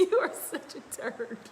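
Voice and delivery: high, amused mock insult; laughing scolding. Real, not acted. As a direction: scold him through real laughter. Laughing at partner's teasing.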